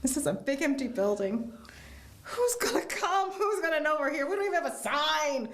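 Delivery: put-on a puzzled child asking why they're here; childlike disbelief. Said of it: "I'm like".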